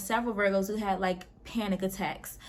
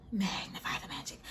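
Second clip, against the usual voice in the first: hushed, breathy title whisper; mystical whisper; 3 semitones lower, 9 dB quieter than usual. Acted. Naming the group meditation to viewers, in a whisper. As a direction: whisper the name like a spell.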